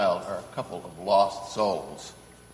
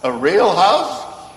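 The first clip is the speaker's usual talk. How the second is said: slow, higher, louder astonished echo; amazed; 7 semitones higher, 6 dB louder, much slower than usual. Acted. Santa echoing the girl's wish in slow astonishment. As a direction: draw out the surprise as if it's absurd.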